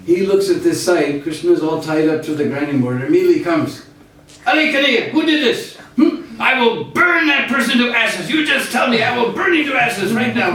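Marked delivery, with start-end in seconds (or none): 4.5-10.5 put-on high, fierce young voice threatening revenge; indignant boy. Balaram finding Krishna tied up, high indignant threat. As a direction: rage like a protective big brother.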